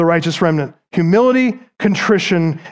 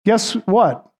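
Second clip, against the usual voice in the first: usual speaking voice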